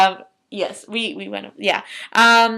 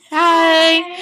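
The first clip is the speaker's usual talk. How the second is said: creepy voice; 9 semitones higher, 7 dB louder than usual. Pretend. Creepy greeting to camera.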